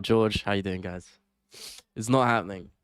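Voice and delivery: ordinary talk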